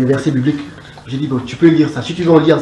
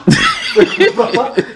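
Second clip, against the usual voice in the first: a short, high, real laugh; burst of laughter; 12 semitones higher than usual. Real, not acted. Rit de l'accent camerounais. (éclat de rire aigu et sincère)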